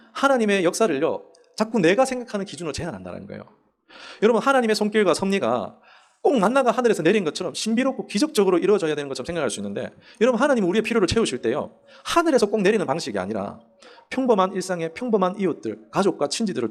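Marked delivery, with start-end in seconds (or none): none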